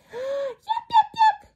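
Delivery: high, loud little yaps; yapping puppy. Acted. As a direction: yap like an excited puppy. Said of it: "Can you yap like a puppy?".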